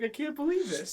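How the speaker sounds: high-pitched imitation of disbelief; incredulous, high quoted voice; mock disbelief. Acting out Garrett's disbelief.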